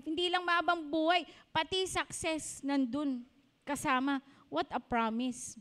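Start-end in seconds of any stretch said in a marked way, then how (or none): none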